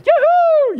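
a sudden very high, loud "Yoo-hoo!"; mock gleeful whoop. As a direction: whoop like a carefree sinner celebrating. Mocking sinner dancing for joy.

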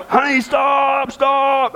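high, slow call of stop; calling after wife. Retold or imitated. Husband chasing his wife down the street, calling out. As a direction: call out to her to stop, drawn out. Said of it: "I go chasing after Brandy".

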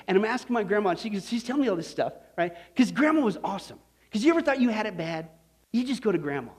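normal talking voice

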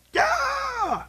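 sudden high-pitched drawn-out yell; wordless warning scream; high alarm yell. Acted. Yelling warning to someone in danger.

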